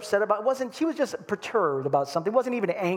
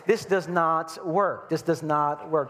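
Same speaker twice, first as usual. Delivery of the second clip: ordinary delivery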